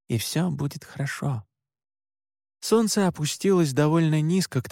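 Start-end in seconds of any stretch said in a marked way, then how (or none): 0.1-1.4 soft, hushed reassurance; quiet repetition. A narrator's reading. Oscar reassuring his nervous brother before the job, quiet and soft. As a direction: drop the volume and reassure quietly. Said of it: "тихо повторил Оскар".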